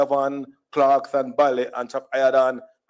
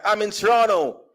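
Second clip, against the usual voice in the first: mock Toronto twang, slightly higher; foreign twang; 4 semitones higher than usual. Shown as a demonstration. Jamaican mimicking emigrants in Toronto, foreign twang. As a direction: put on a North American twang. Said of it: "I wasn't giving him twang like".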